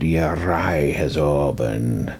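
put-on Scottish, slow and low; Scottish accent. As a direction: imitate his Scottish accent, drawing out each word. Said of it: "in this Scottish accent".

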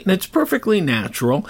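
ordinary delivery

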